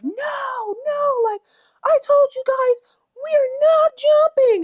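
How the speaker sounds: very high, loud whiny mock voice; mocking squeaky voice. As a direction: squeak it out like a prissy denial. Mocking cast members' denial.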